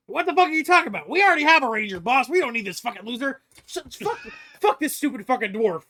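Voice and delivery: high, loud, fast outburst; indignant ranting sidekick. Acted. Cassidy fears a new ranger will take his position, ranting. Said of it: "Cassidy is just kinda going off".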